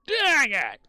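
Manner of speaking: loud high frustrated outburst; frustrated shout. Real, not acted. Loses the fish in the game.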